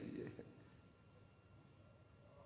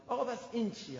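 a raised, challenging questioner's voice; mock questioner; 7 semitones higher than usual. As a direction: play the student objecting from the crowd. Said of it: "خودمون این سوالا رو... بگیم". (صدای پرسشگر معترض، با لحن بالا)

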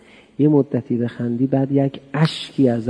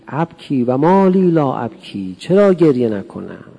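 slow, louder chanted prayer; prayer recitation; 8 dB louder, slower than usual. Acted. Preacher reciting the Abu Hamza prayer, slow and loud. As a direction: intone it as a lament.

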